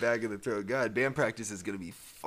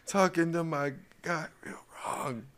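movie character impression; 5 semitones higher than usual. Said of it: "it was from Joe Dirt".

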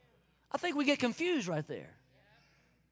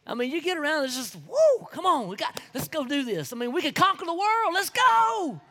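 excited hype; 4 semitones higher, 6 dB louder than usual. Acted. Hyping like enthusiastic church friends.

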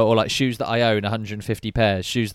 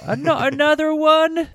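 high, loud imitation of a disbelieving mum; mock exasperated mum; 5 dB louder than usual. Mum seeing yet another new pair, mock outrage. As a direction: pitch it right up in hammed-up disbelief. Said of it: "that's what I'd get from my mum".

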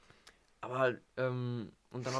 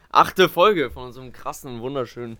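hammy announcer; 3 semitones higher, 16 dB louder than usual. Pretend. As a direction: stretch it like a cheesy host. Hammy podcast intro.

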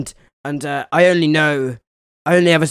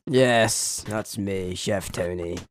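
low, dropped chef voice; deep chef voice; 7 semitones lower, 6 dB quieter, much slower than usual. Acted. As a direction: play a gruff chef deep down. Plays Chef Tony character.

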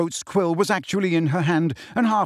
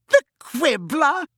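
much higher and louder, slow, laughing out the name; cackling; 13 semitones higher, 4 dB louder, slower than usual. A narrator's reading. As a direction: cackle it in mocking disbelief. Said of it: "she said, cackling".

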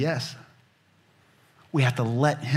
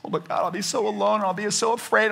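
higher, mock-fearful pleading voice; whiny worried plea; 7 semitones higher than usual. Person afraid to give up a relationship, mock whine. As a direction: play a scared person bargaining with God. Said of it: "protest to God".

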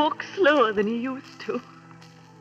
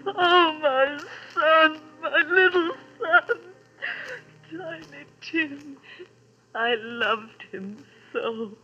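tearful grief; slower than usual. Acted. Mother grieving again.

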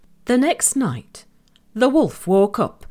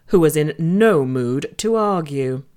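usual speaking voice

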